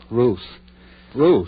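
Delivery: loud call to wake her. Acted. [name] calls Ruth to wake her, loud call. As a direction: call her name to rouse her. Waking her by calling her name.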